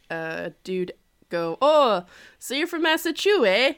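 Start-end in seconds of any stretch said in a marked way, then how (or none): 1.6-3.7 higher, louder mock Canadian accent. Toronto dude asking a visitor about home, Canadian accent. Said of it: "So, that was my Canadian accent".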